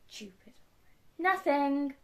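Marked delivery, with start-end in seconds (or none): 0.1-0.5 muttered grumble. Acted. Villain cursing out of mum's hearing, muttered. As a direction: grumble so the listener can't hear. Grumbles so mum won't hear.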